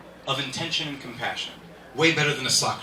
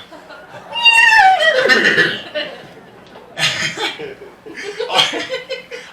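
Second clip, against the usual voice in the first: long, high, loud laughing fit; big laugh; 9 dB louder than usual. Real, not acted. Comedian cracking up at his own joke. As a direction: burst out laughing.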